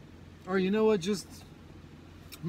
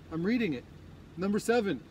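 ordinary talk, nothing marked

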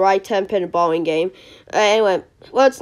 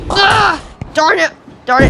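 high-pitched yelp of annoyance; yelp, then curse mildly, pitch up; frustrated outburst; 6 semitones higher, 4 dB louder than usual. Real, not acted. Player just missed the split spare, frustrated outburst.

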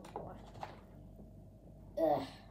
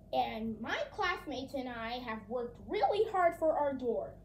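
ordinary talk, nothing marked

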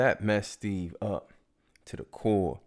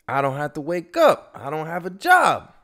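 annoyed complaint; 9 semitones higher, 10 dB louder than usual. Retold or imitated.

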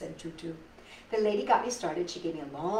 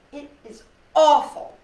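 put-on upset child's cry; 6 semitones higher, 11 dB louder than usual.

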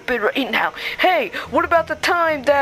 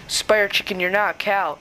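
ordinary delivery

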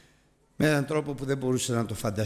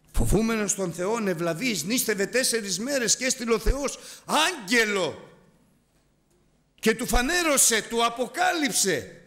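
high-pitched impassioned sermon; preacher rising to a fervent peak; fervent preaching; 10 semitones higher than usual. Acted. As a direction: lift the voice and preach it out. Preaching retold story.

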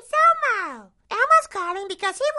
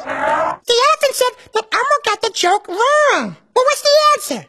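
Elmo voice. Acted. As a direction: speak as cheerful Elmo to a child. Elmo's voice throughout.

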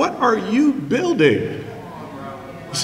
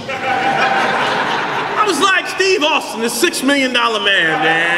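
slow motion; slower than usual. Retold or imitated. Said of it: "falling in slow motion".

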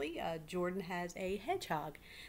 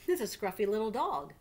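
louder, fond mother voice; voiced mother; 2 semitones higher, 8 dB louder than usual. Mother noticing the scruffy dog, louder and fond. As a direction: point out the dog with delight.

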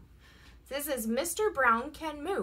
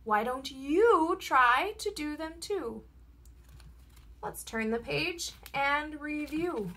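ordinary delivery, nothing marked

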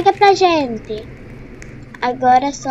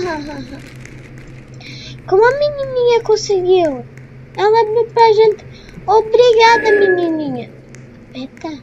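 ordinary talk

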